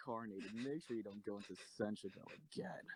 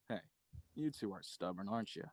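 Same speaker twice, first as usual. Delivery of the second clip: ordinary delivery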